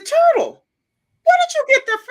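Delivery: high, loud startled question; shocked mom. Retold or imitated. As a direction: gasp at what the kid said. Mother surprised at child.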